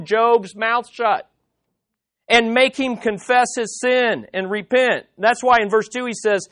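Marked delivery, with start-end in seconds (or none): none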